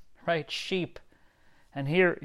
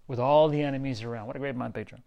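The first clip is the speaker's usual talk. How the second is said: ordinary delivery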